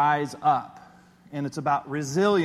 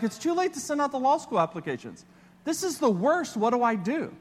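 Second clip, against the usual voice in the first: high, anxious self-quote; panicked inner voice; 8 semitones higher than usual. Retold or imitated. To himself about missed law school deadlines, panicked. Retold panic over applications.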